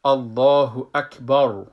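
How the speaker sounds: الله اکبر drawn out slowly with a full heavy ending; full mouth. Shown as a demonstration.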